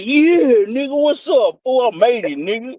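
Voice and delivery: high put-on voice bragging; mock boastful bro. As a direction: brag it in a high goofy voice.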